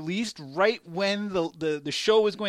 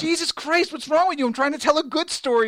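exasperated; 8 semitones higher, 4 dB louder than usual. Real, not acted. Friend interrupts his cow story.

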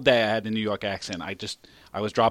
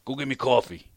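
put-on New York accent. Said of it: "now I'm doing it 'cause I can't stop".

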